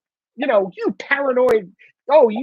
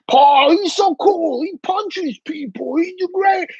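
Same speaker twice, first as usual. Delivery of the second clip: slow, higher dim-witted fan voice; mocking dopey fan; 6 semitones higher, slower than usual. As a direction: drawl it like a starstruck fool. Mocking fans of Chapman.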